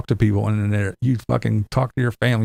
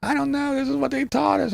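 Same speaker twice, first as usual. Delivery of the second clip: put-on squeaky kid voice. Grandkid answering grandpa.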